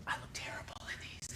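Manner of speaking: hushed whisper, half embarrassed; whispered confession. Acted. Customer whispering to the cashier about bad photos, hushed. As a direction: lean in and whisper the shame.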